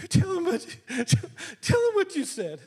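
high, urgent, excited plea; urgent demand. Retold or imitated. Woman pressing the pastor to repeat the word, high and urgent. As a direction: push him hard to say it again.

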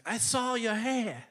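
imitated Irish lilt, slowly; Irish accent. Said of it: "He's got this great accent".

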